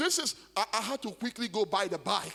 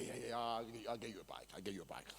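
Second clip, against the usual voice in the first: much softer, lower, brushing it off; dismissive mumble; 10 semitones lower, 14 dB quieter, faster than usual. Retold or imitated. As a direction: toss it off half-heartedly, barely audible. Brushing off son's reminder.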